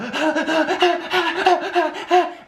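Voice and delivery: high, rapid gasping pants; acted desperate panting. Runner near his limit on the treadmill, mock frantic panting. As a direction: pant as if dying on the treadmill. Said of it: "I'm, like, dying".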